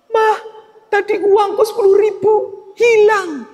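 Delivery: put-on high, whiny little-child voice; whining child. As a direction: whine like a small kid who lost his money. Child crying to mother over money.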